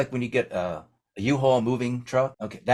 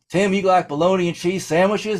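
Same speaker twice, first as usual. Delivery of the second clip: higher-pitched imitation of a Southern driver; South Carolina driver; 7 semitones higher, 4 dB louder than usual. Local offering visitors a sandwich, higher mock Southern voice. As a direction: put on an eager small-town local. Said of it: "tow truck driver comes in from South Carolina".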